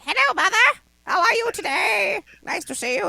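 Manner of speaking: high, bright, sing-song put-on politeness; sweet mock greeting. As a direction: chirp it like a too-sweet daughter. Greeting her mother, put-on voice.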